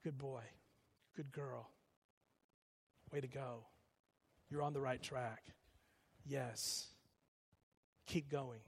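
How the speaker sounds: soft, gentle encouragement in short phrases; quiet inner voice, pausing between each affirmation; Holy Spirit. Retold or imitated. Voice in your head guiding you, softly encouraging. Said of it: "the Holy Spirit voice saying".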